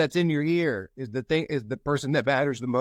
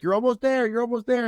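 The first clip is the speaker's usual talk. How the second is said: coach urging; 10 semitones higher than usual. Retold or imitated. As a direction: cheer the player on to the line. Coach urges crawling player.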